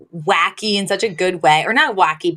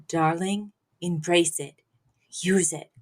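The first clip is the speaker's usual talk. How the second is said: hushed secret; 2 semitones lower, 7 dB quieter than usual. Retold or imitated. Mother passing on a secret.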